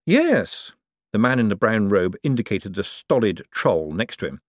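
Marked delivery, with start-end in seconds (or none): 0.1-0.7 put-on higher young man, curt; a young buyer, nervous and short; young man's voice. Young man answering the forger, clipped yes.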